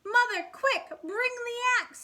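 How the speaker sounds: storybook shout for help. Acted. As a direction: call to mother in a panic. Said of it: "he shouted".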